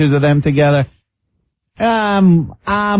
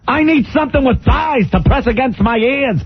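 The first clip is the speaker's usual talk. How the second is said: Regis impression; 10 semitones higher than usual. Mocking Regis on air.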